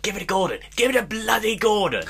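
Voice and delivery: high, insistent touchline dad yell; shouting dad impression. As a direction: imitate a dad yelling from the sidelines. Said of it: "his dad's always like".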